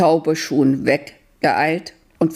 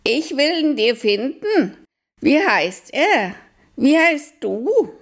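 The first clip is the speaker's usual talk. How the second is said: eager owl; 10 semitones higher than usual. Acted. Owl offers help. (sehr hohe, langsame Eulenstimme)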